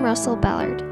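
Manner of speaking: ordinary talk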